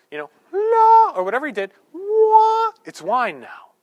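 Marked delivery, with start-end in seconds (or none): 0.5-1.1 a squeaky high sung "la" for a miracle; playful magic sound effect. Pretend. Miming a magic flourish. 1.9-2.7 a squeaky high "wah" for a miracle; a high, louder conjurer's flourish; playful ta-da sound; high magic "wah". Pretend.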